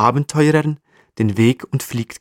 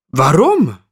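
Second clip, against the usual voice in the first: incredulous why; 4 semitones higher, 4 dB louder than usual. Acted. Marmot asks in disbelief.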